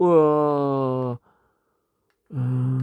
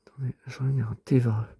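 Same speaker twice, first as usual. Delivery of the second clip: whispered aside to herself; whispering as she searches; 2 semitones lower, 7 dB quieter, faster than usual. Real, not acted. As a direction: drop to a breathy whisper while looking. Aside while searching the app.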